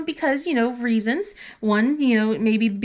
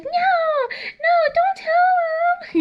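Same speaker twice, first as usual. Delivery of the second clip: much higher, slow, crying plea; panicked daughter; 3 dB louder, much slower than usual. Retold or imitated. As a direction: wail it like a teen about to be exposed. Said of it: "And she's like".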